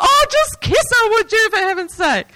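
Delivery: high, impatient heckle at the screen; mock-exasperated cry at a romance; exasperated movie-goer. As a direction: urge the shy hero on. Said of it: "the people who yell at the screen".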